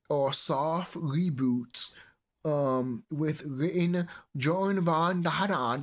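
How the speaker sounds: slow, put-on reading voice; mock article reading. Vlogger mock-reading the news, slow put-on voice. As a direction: read it like a stiff press release, slowly.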